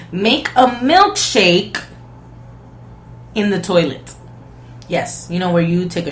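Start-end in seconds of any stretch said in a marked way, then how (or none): 0.1-4.1 drawn out, each word slow; slow disbelieving repeat; slow repeat for emphasis. Shown as a demonstration. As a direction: spell it out slowly so it sinks in. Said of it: "hear that one more time".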